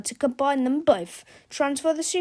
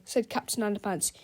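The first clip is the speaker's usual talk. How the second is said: ordinary delivery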